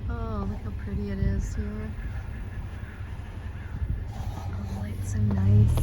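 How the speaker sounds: hushed admiring whisper; a soft awed whisper. Real, not acted. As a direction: breathe it softly. Whispering in quiet graveyard.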